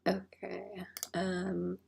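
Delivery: soft whispered okay. Real, not acted. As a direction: breathe it out unvoiced. Whispered aside.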